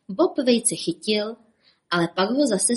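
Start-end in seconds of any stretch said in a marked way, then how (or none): none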